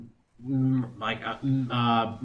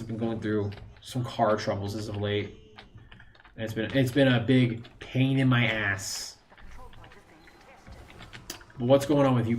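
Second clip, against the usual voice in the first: normal talking voice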